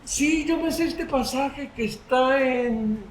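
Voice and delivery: high, drawn-out voice, groping for the reference; unsure believer. Acted. Congregant claiming to know the passage, hesitant and high. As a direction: mimic someone trailing off while trying to remember. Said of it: "Hay personas que dicen".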